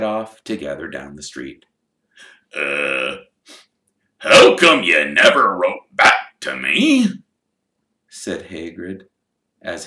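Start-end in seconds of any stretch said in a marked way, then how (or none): 2.5-7.2 loud, slow gruff question; hurt giant asking why; Hagrid's voice. Acted. Hagrid asking Harry why he never wrote back, in a slow gruff voice.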